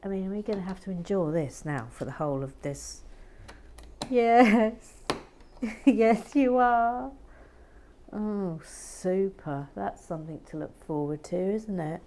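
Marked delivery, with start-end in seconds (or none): 4.1-7.1 teasing. Pretend. Teasing reply. 8.1-9.7 sarcastic. Real, not acted. Sarcastic response.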